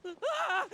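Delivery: sharp high scream of fear; frightened cry. Acted. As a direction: yelp out in terror. Villager cornered by monsters.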